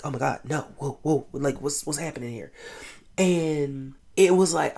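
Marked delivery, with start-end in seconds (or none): 0.1-2.5 soft, hushed alarmed voice; whisper-close panic. Retold or imitated. The guy watching his friend's eyes go blank, hushed and alarmed. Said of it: "the guy's like".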